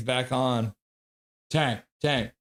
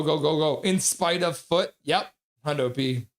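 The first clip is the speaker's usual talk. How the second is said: usual speaking voice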